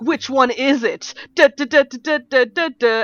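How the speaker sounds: put-on excited boyfriend humming a fencing beat; imitated eager boyfriend. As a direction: ham up the giddy fencing nerd.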